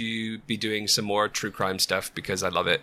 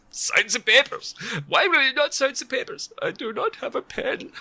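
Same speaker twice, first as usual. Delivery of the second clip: much higher, squeaky sketch character; high comic voice; 14 semitones higher than usual. Pretend. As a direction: play the reluctant one in a high silly voice. [name] and [name] sketch voices.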